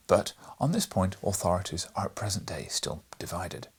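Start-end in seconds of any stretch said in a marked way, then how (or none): none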